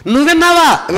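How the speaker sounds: high-pitched acted interrogation; pointed courtroom question; mock judge questioning a witness.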